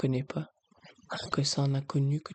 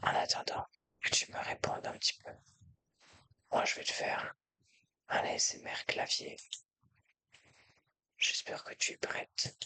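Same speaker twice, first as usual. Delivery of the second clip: soft ASMR whisper close to the mic; right in your ear; 6 dB quieter than usual. Real, not acted. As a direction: breathe the words right into their ear.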